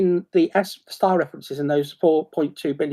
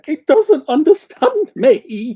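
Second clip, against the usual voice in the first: mock frustrated user; 10 semitones higher, 6 dB louder, much slower than usual. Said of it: "screaming, going".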